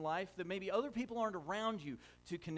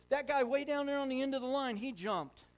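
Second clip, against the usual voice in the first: put-on high, loud innocent little-boy claim; a five-year-old inventing an alibi; kid excuse; 10 semitones higher, 9 dB louder than usual. Kid blaming an invisible player.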